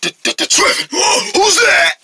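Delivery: high-pitched, gasping alarm; startled fear. Acted. Rabbit hearing someone approach, startled. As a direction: call out high, as if spooked.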